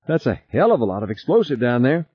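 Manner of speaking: high-voiced worried warning; alarmed. A narrator's reading. Passenger warns pilot about explosives below, high and alarmed. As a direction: warn with rising alarm.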